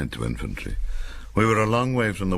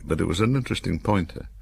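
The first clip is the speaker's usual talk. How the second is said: ordinary delivery, nothing marked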